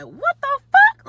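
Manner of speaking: high, slow "what the fuck"; shocked disbelief. Retold or imitated. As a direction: stretch it out in stunned shock. Hearing they tried to kill her.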